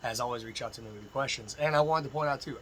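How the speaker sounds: usual speaking voice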